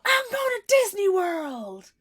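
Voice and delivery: very high, loud, slow whoop; hammed-up ad cheer. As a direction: shout it like the winner in the ad. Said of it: "it's kind of like".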